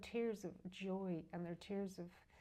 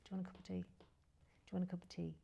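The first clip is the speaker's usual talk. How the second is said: soft repeated offer of tea; hushed, careful question to a dying mother; volume turned down; 5 dB quieter than usual. Retold or imitated. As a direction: ask it gently with the volume right down. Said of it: "turn the volume down and go".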